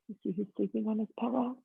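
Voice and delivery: ordinary delivery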